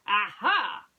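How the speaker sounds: loud, ringing discovery; detective spotting the clue; triumphant aha. Acted. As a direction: shout it in triumph. Basil's discovery exclamation.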